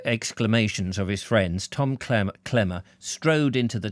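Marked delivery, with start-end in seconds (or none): none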